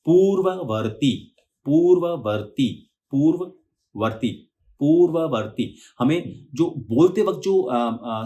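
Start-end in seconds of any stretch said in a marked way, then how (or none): 0.0-5.8 पूर्ववर्ती said very slowly, the व sound unbroken; continuous sound. Shown as a demonstration. Teacher drilling a hard word slowly for students. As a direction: stretch पूर्ववर्ती slowly without breaking the व. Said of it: "sound है वो continue रखना है".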